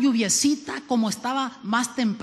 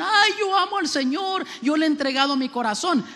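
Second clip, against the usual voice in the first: mocking pious believer; 6 semitones higher than usual. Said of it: "muchos cristianos que dicen".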